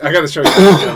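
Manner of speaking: normal talking voice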